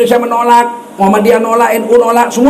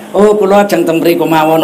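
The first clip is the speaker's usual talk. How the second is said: lower, polite Javanese accent reply; 7 semitones lower than usual. Shown as a demonstration. Preacher plays the Javanese reply, lower polite Javanese accent. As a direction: answer courteously in a Javanese lilt. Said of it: "pakai logat Jawa".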